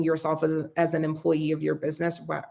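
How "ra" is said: ordinary talk, nothing marked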